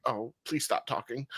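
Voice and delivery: soft, understated exasperated aside; weary plea. Retold or imitated. Viewer begging the general manager to stop, quiet exasperation. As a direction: quietly beg them to stop, half under the voice. Reacting to an annoying character's speech.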